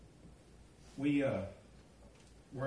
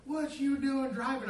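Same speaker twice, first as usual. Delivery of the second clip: high, loud, drawn-out demand; mock scolding; 10 semitones higher, 6 dB louder, slower than usual. Sergeant confronting the prank driver, high loud mock scolding. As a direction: scold like an exasperated sergeant, in jest.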